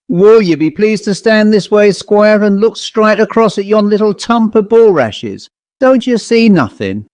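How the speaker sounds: a village smith calling the squire over, uneasy; country blacksmith. A narrator's reading. Blacksmith figure voiced by narrator.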